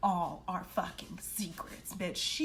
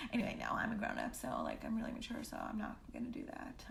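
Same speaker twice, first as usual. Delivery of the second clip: mock-mature whisper; 7 dB quieter, faster than usual. Real, not acted. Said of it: "mock mature aside".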